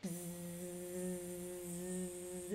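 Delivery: soft unvoiced buzzing; a soft mock bee buzz.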